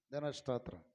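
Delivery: loud, low proclamation; loud praise call. Real, not acted. Preacher calling praise to the congregation, low and loud. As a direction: call it out to the crowd.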